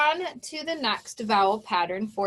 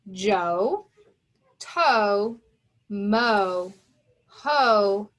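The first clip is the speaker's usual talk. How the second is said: each word said alone, louder and separate; listing words clearly; 3 semitones lower, 4 dB louder, much slower than usual. Shown as a demonstration. Teacher reading the word list aloud to students, clear and separate. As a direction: call out each word to the class. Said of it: "have the following words".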